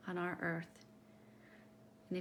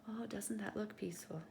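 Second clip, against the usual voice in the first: hushed, awed whisper; 8 dB quieter than usual. Acted. Showing a child a peaceful page, whispered. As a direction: whisper it so as not to wake anyone. Admiring a calm picture.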